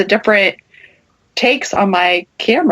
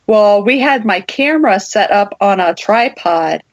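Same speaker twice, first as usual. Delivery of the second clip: ordinary talk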